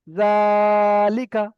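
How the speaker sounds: "জালিকা" with a very long level jaa, short lika; drawn-out syllable. Shown as a demonstration. Teacher repeats for students, jaa of "জালিকা" stretched long. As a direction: hold the first syllable of "জালিকা", then drop quickly through the rest. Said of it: "আমি এটাই টান করবো".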